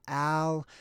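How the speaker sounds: Al with a flat A, as in "pal"; pronunciation variant. Shown as a demonstration. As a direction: say Al like the name, short A. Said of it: "could be pronounced".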